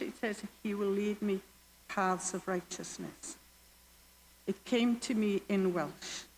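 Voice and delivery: ordinary talk, nothing marked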